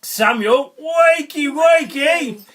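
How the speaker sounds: high, loud, drawn-out calling; group call-out yell. A narrator's reading. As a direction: call the name, then yell the wake-up cry. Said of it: "we're all gonna yell together".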